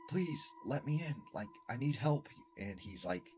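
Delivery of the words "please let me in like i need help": put-on higher pleading young girl's voice. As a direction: plead to be let in, a bit higher. Girl pleading at the door.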